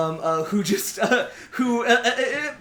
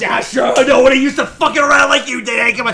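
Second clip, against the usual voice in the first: loud party voice; 4 semitones higher, 9 dB louder, faster than usual. Acted. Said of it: "just goes".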